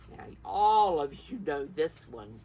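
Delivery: ordinary delivery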